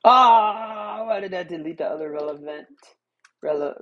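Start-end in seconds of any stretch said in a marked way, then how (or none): 0.0-1.0 frustrated groan. Real, not acted. Frustrated at deleted note.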